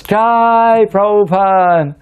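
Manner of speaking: high, drawn-out triumphant cheer; loud, long-held exultant call; acted cheer. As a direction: imitate a crowd of devotees cheering. Crowd cheer acted in story.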